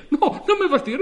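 loud, high, laughing plea; half-laughing protest, pitch up; laughing plea rising high; pleading cry. Acted. Customer pleads with operator.

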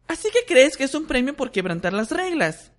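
put-on high, annoyed know-it-all girl; irritated girl. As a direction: scold them with disapproval. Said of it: "se oyó una voz irritada".

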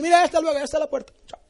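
despacha al huésped más agudo y fuerte; despedida cortante. Retold or imitated. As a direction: lo saca rápido, con prisa fingida. Anfitrión despide rápido al huésped. (despedida cortante y apurada)

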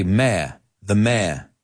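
"mayor" as one syllable, rhyming with a British "mare"; British pronunciation. Shown as a demonstration. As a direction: say "mayor" as meh, no r. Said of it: "the British say this word differently".

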